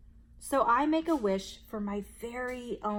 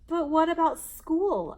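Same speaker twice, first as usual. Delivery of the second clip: put-on higher, louder anxious child; child's anxious higher question; worried question; 7 semitones higher, 7 dB louder than usual. A narrator's reading. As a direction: blurt out the worry. Worried child asks mom.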